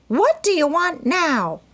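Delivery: put-on high, loud, sharp old woman; a cross old aunt demanding. A narrator's reading. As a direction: snap it out over the spectacles. Aunt March snapping at visitors.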